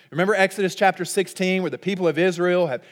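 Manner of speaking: ordinary delivery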